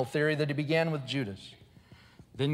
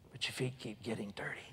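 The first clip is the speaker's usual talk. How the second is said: God whispering to Gail; soft whisper; 10 dB quieter than usual. Retold or imitated. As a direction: whisper "but your feet keep getting dirty" gently. God speaking softly to Gail.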